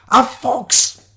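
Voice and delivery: high, loud and exasperated; exasperated scolding. Acted. Shiloh to companion spooked by a fox, exasperated. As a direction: snap it out at a jumpy companion.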